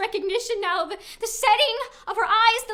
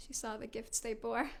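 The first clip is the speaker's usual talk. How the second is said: whisper; 8 semitones lower, 12 dB quieter than usual. Acted. Hushed telling of the princess.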